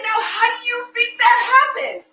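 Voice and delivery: very high sing-song mom voice; hammed-up sweet curiosity; feigned wonder. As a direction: quiz a child with fake amazement. Mother feigning wonder to son.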